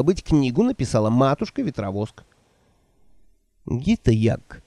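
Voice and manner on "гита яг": ominous. A narrator's reading. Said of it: "зловеще произнесла она".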